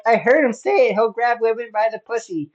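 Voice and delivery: high, loud mock alarm; freaked out, imitated. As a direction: insist in a hammed-up panic. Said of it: "so freaked out about Trump grabbing women".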